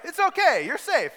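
high, loud call to calm a stranger; frantic reassuring shout; loud reassurance. Retold or imitated. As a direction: yell it so he stops panicking. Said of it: "That's what a serial killer would say".

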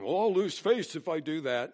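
mock proud husband. Pastor voicing a husband afraid to lose face, mock worried. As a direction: voice the man's worried excuse, mockingly.

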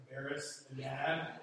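ordinary talk, nothing marked

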